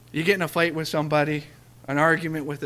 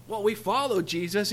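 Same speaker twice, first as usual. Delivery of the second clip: ordinary talk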